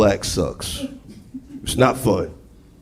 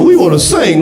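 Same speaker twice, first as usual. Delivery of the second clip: carolers; 9 semitones higher, 4 dB louder than usual. Acted. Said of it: "talking about".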